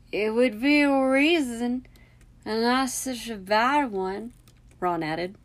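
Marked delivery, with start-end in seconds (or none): 0.1-4.3 slow, higher, drawn-out character voice; put-on Ron voice. A narrator's reading. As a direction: draw it out in a different voice.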